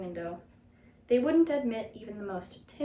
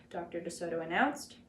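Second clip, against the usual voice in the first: ordinary delivery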